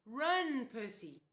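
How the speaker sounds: high, loud urgent shout. A narrator's reading. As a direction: urge him to flee.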